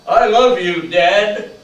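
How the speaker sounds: the son calls out to his father, loud; swelling cry. Retold or imitated. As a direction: let it burst out of him. Son's love repeated, swelling.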